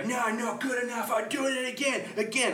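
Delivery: high-pitched mock fussy performer; mimicking Normie. Teasing co-host about endless retakes, high mimicry. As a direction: put on a high voice, fussing over retakes. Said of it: "and then you'll go".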